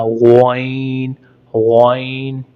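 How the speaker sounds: वाइन thick from the throat, drawn out; thick throaty letter. Shown as a demonstration. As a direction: make वाइन thick and heavy. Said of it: "मोटा करके पढ़ना है". (वाइन मोटा करके हलक से)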